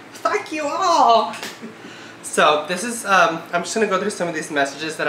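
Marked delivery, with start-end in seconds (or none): 0.1-1.3 high, slow, drawn-out yell of fuck you all; a playful yell at all the senders; mock outburst. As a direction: fling it out at everyone in a hammed-up holler. Muttered curse then shouted.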